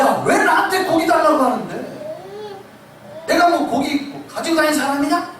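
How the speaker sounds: mock-annoyed, half laughing; irritated retort. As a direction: play Moses fed up with the crowd. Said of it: "백성에게 짜증냈다고 했죠".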